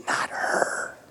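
whispered aside, barely voiced; hushed deadpan punchline. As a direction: breathe it out like a confession.

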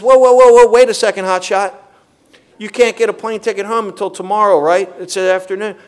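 loud, high "whoa, whoa" that settles into a teasing talk-down; sponsor reining him in. Retold or imitated. Sponsor on the phone cooling down the overexcited caller, loud then teasing. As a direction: pull the hotshot back down to earth.

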